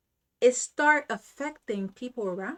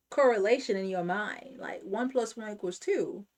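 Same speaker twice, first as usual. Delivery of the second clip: usual speaking voice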